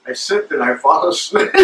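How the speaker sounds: ordinary talk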